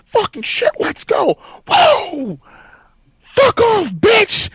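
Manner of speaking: very high, hyped-up victory screaming; going off. Retold or imitated. Player flexing, hyped beyond hype after kill, screaming. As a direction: re-enact an unhinged celebration yell. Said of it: "and I'm just off".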